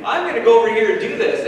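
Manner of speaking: high, loud, quick burst of resolve; hammed-up eager announcement; eager decision. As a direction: act out someone jumping at their own idea. Acting out eager human decision.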